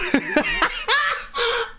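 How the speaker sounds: high breathless cackle; helpless laughter. Real, not acted. Cracking up at their own story, helpless laughter. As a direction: burst out laughing, high and wheezy.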